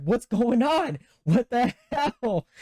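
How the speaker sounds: high, baffled frustration; retold comic exasperation; exasperated outburst. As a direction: cry it out high, drawing out the last words. Retold exasperation at failed card.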